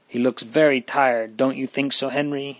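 ordinary delivery

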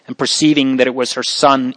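ordinary delivery

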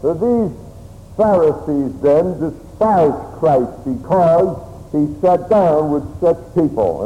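ordinary talk

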